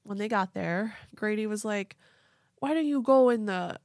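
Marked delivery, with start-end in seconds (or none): 2.6-3.8 put-on unsure Pennsylvania accent, louder and higher; attempted Pennsylvania accent. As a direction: try a Pennsylvania accent, then give up. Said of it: "don't know how to do this accent".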